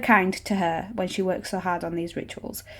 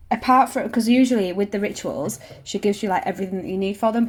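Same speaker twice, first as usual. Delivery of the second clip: ordinary delivery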